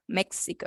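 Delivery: México said the English way, dropped to a whisper; English Mexico. Shown as a demonstration. Teacher shows how she said México to the student. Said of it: "yo no dije México".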